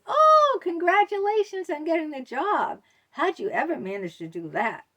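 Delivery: high, loud, sugary congratulations; an exaggerated sweet voice turning snide at the end; backhanded compliment. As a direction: fake sweetness that hides a dig. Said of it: "as a backhanded compliment".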